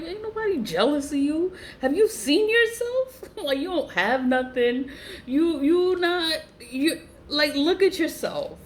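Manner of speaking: high-pitched, incredulous comeback; scornful retort. Retold or imitated. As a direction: act out mocking disbelief, pitch jumping up. Acted scornful retort to her claim.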